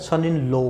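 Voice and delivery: 'लॉ' closed to 'lo'; 'सन in लॉ' with round o in the last word; students' mispronunciation. Retold or imitated. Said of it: "class के अंदर इसको बोलते हैं".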